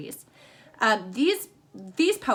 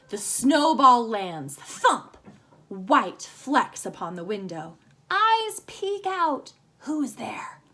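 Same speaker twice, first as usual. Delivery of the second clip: slow, expressive haiku reading; poem reading; much slower than usual. Shown as a demonstration. Teacher reading a winter haiku aloud to kids, slow and expressive. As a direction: read the poem slowly, savoring each image. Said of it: "Here's a winter haiku".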